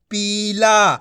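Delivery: पीला with long ee and long aa; drawn-out vowels. Shown as a demonstration. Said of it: "खींच के पढ़ देंगे".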